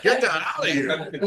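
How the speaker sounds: dismissive. Retold or imitated. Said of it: "It's like".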